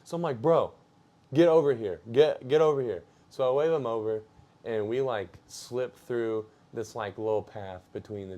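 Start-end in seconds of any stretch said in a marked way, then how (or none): 0.4-3.0 louder, higher call to a friend; raised-voice, hurried summons; retold urgent call. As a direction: re-enact calling a buddy over urgently. Urgently calling friend over in story.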